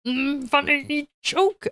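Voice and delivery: drawn-out attempt at a wise alien's croak; Yoda. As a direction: put on a slow, strained puppet-like voice. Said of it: "do a Yoda voice".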